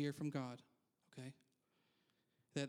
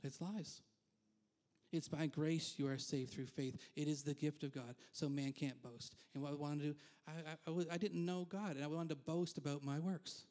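usual speaking voice

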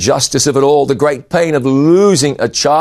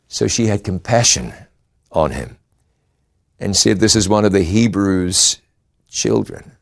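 softer, lower, tender preaching; 4 semitones lower, 4 dB quieter than usual. Real, not acted. Preacher musing on compassion, softer and lower. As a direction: draw them in gently, softly.

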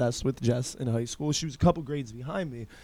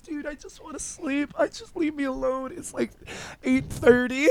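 put-on sleepy annoyed girl; 10 semitones higher than usual. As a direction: beg to be left alone, high and tired. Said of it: "And she's like".

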